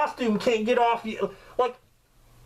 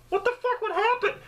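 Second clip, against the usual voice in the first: high, alarmed what-the-fuck; a jolt of panic at the thought; startled outburst; 12 semitones higher, faster than usual. Real, not acted. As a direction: blurt it out in sudden alarm. Imagining being stuck in costume.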